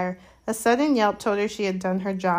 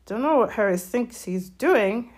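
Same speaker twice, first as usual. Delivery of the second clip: Hagrid mumbling. A narrator's reading. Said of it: "Hagrid mumbled".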